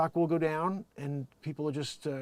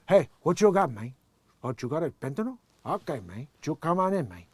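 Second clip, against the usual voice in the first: put-on louder Cuban gangster accent; Scarface voice; 6 dB louder than usual. Playing a Scarface gangster at the border in a loud Cuban accent. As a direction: swagger it like a Cuban mob boss. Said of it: "bring a Scarface reference back".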